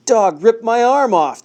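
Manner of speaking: high, loud, slow wailing shout. A narrator's reading. Wounded suspect fakes agony, loud shout. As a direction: overplay the injury loudly. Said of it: "the man shouted".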